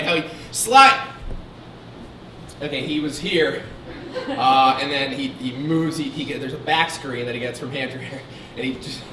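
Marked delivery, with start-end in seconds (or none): none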